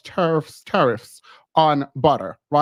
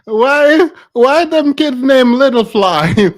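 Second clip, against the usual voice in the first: louder and higher, slowed, can't believe it; incredulous shout; 7 semitones higher, 8 dB louder, slower than usual. Real, not acted. Host to viewers about the kids' names, incredulous shout. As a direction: demand why out loud.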